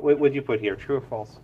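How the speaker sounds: ordinary delivery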